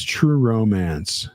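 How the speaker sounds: drawn out slowly. Real, not acted. Recalling the movie title, slow and drawn out.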